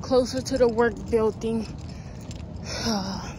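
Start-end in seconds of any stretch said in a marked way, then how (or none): 1.7-2.8 tired sigh. Real, not acted. Tired after walking. 2.8-3.3 winded from walking; puffing between words; out of breath. Real, not acted. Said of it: "I'm always out of breath".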